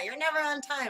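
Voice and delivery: mock complaint. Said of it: "People get mad".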